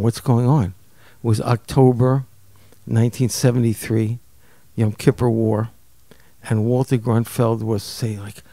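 usual speaking voice

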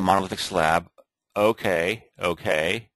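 usual speaking voice

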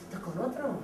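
put-on jealous boyfriend muttering through gritted teeth. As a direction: spit it between clenched teeth. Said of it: "entre dientes".

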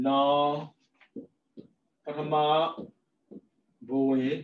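normal talking voice

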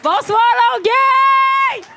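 long, high shout out to a crowd; stage greeting shout. Pretend. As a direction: call it out like a rock star to the stadium. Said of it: "J'ai toujours rêvé de faire ça". (grand salut de scène lancé à la foule de Longueuil)

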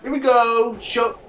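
ordinary talk, nothing marked

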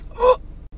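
high sudden gasp; sharp gasp. Acted. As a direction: gasp as the swab goes in. Kid gasps at swab.

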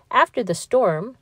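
ordinary delivery

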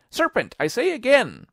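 very high, loud bird voice; high-pitched loud accusation; insistent pigeon. A narrator's reading. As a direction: insist shrilly as an upset pigeon. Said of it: "but in a more subdued tone".

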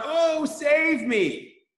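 drawn-out, high, loud cry for help; panicked cry. Pretend. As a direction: yell it like a drowning kid. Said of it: "and he yells out".

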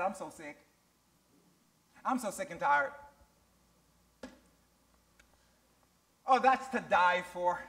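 low, softened mock complaint. Preacher mimicking how Christians talk, mildly mock complaining. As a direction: lightly imitate a weary complainer. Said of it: "But Christians talk like that".

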